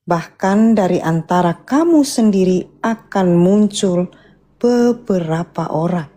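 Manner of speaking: usual speaking voice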